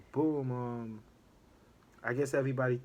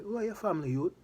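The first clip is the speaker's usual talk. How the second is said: higher, patois-flavored question; Jamaican accent; 7 semitones higher, much faster than usual. Retold or imitated. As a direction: pitch it up like a Jamaican elder asking.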